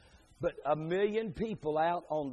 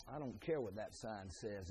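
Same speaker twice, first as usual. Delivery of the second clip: low, soft mock indifference; dismissive hunter; 6 semitones lower, 11 dB quieter than usual. Hunter with a gun ignores the posted sign, quietly dismissive.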